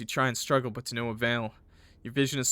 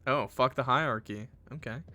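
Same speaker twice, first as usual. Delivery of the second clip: ordinary delivery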